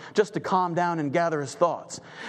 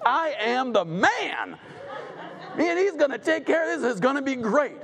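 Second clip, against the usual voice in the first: smug gloating; 6 semitones higher than usual. Acted. Haman gloating, voiced high.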